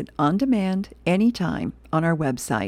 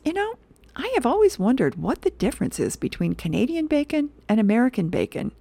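lightly voiced, higher-pitched puzzled customer; musing diner customer; 7 semitones higher than usual. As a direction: wonder aloud in a high comic voice. Voices a curious comic-strip diner customer.

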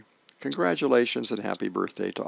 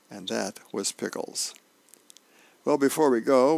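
ordinary talk

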